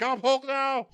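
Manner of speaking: high-pitched, strained comic monster yell; hammed-up proclamation, way up high; Hulk. Bruce announcing he has changed, high mock yell. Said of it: "And then Hulk, he's like".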